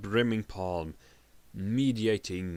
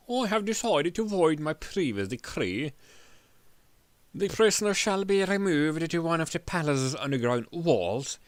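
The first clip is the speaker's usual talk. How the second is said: raised, gloating royal decree; king's decree; 5 semitones higher than usual. Acted. King sentencing the prisoner, a high gloating decree. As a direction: savor the cruel sentence.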